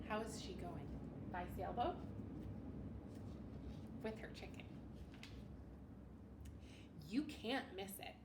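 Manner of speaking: usual speaking voice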